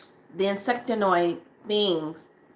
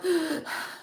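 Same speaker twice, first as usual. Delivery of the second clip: sharp panicked gasp; 8 semitones higher, 4 dB quieter than usual. Retold or imitated. Stepping onto her path, panicking.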